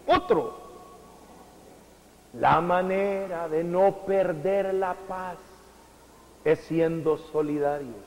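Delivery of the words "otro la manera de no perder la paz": normal talking voice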